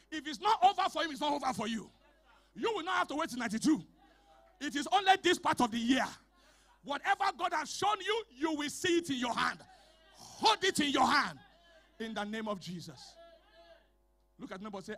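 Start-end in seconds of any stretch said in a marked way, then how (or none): 0.1-11.4 loud, shouted declaration; preaching at full force. Acted. Pastor decreeing over the congregation, loud shouted preaching. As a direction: thunder the blessing over them.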